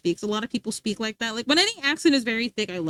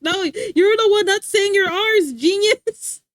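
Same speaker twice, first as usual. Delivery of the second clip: loud, high-pitched sarcastic comeback; playful indignant clapback at a friend; mock retort; 7 semitones higher, 6 dB louder, slower than usual. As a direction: throw the teasing back, drawn out and higher. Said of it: "But, like".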